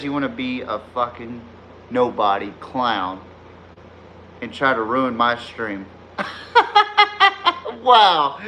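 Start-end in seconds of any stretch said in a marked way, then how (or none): 6.2-7.8 squealing cackle; high laugh. Real, not acted. Mocking the banned chatter, high cackle.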